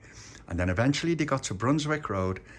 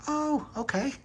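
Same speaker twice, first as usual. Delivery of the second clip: surprised; slower than usual. Acted. Shop lady surprised by order.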